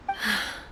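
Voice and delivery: soft weary sigh after grief; heavy sigh. Acted. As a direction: let out a quiet sigh of loss. Grieving god remembers lost friend.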